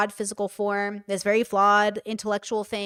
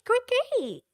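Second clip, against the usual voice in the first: very high, squeaky service voice; exaggerated high-pitched customer pleasing; 12 semitones higher than usual. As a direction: squeeze it up into a tiny high service voice. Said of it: "my brother and I talk like that".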